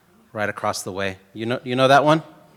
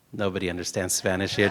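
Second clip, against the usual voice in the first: usual speaking voice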